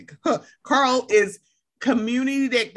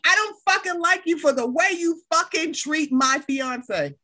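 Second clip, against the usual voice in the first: retold angry confrontation; 7 semitones higher than usual. Retelling Kyle confronting someone.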